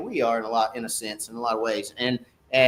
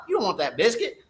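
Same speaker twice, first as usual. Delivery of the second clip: normal talking voice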